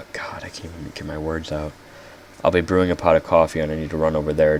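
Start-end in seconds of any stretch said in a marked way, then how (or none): 0.1-1.7 unvoiced frustrated whisper. Real, not acted. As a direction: whisper it to yourself, exasperated. Flustered aside while losing words.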